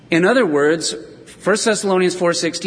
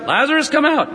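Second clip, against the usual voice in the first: Jesus calling out; 10 semitones higher than usual. Acted. Jesus calling into the tomb, a high ringing call. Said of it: "He shouted".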